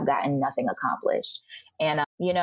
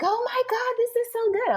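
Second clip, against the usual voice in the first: much higher, gushing "oh my God"; mock gushing praise. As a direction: squeal it like a thrilled churchgoer. Church people praising her sermon.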